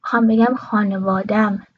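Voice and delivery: normal talking voice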